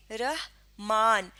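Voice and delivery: رحمان slowly split, "rah... maan"; syllable by syllable. Shown as a demonstration. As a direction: say رحمان slowly with a long second a. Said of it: "رے پہ زبر ہوتا". (رحمان ٹھہر ٹھہر کر، "رَح... مان")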